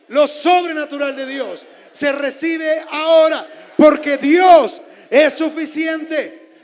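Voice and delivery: loud shouted declaration; preacher leading the congregation's decree, shouting; full-force decree. Acted.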